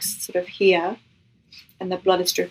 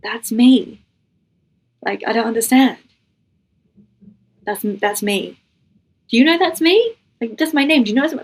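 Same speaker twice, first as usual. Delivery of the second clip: mildly stunned, halting, then rising into a higher question; retold disbelief; 4 semitones higher, 3 dB louder than usual. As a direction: re-enact not being able to take it in. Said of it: "my brain did not compute".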